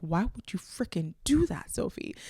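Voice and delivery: whispered scolding of herself; self-scolding whisper. Retold or imitated. Asking herself why she gave it away, whispered. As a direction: hiss a rebuke at yourself. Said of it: "ask myself".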